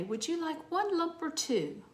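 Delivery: put-on a high, light English accent, politely offering. English host offering sugar with tea, mild English accent. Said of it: "they would say".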